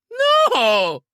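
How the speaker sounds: hoarse scream. A narrator's reading. Owner refuses to enter the safe, hoarse scream. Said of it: "came out like a hoarse scream".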